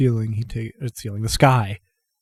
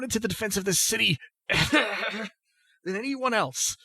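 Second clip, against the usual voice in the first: put-on proud, furious old man; 9 semitones higher, 3 dB quieter than usual. Dying grandfather defends Tyr family to Melly, high strained pride. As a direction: defend the family's honour through a cough.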